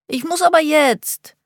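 put-on very high whining little boy, stress on aber; whiny child voice. A narrator's reading. Child insists he needs toilet.

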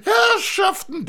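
a gushing fat friend at a reunion; overjoyed fat man. Acted. Fat man greets old friend.

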